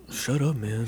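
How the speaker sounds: put-on tossing off a dismissive line like his kid brother; a hint of a bored younger brother; imitating his brother. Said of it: "he kinda talks like this".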